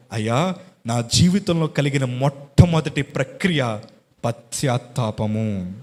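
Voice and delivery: normal talking voice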